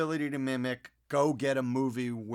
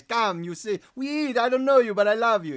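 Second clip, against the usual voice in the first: high, loud, effusive Italian welcome; Italian accent; 6 semitones higher, 9 dB louder than usual. Acted. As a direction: gush like an Italian welcoming a guest. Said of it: "Italians are an inclusive people".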